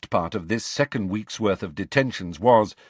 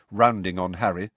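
plain reading voice

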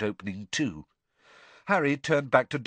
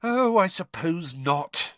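slow, higher resigned sigh; 7 semitones higher, 3 dB louder, slower than usual. A narrator's reading. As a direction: give in with a weary sigh. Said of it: "she sighed".

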